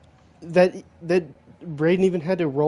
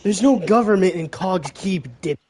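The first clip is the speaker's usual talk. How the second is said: angry captain; 4 semitones higher than usual. Acted. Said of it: "and he's pissed".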